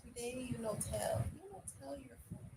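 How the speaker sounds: put-on high, soft baby-talk pout; mock pouting.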